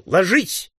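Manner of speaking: high urgent command; shouted warning. A narrator's reading. Leader seeing rifles aimed at them, warning shout. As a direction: cry out to take cover. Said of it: "пронзительно свистнуть и крикнуть".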